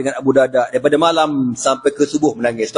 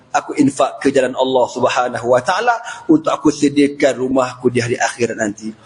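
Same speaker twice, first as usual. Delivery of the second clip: usual speaking voice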